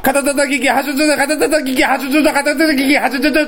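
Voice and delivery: rapid tongue twister. Shown as a demonstration. Challenger shows partner his attempt, rapid tongue twister. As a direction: race through three rounds nonstop. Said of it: "おい、行くぞ、".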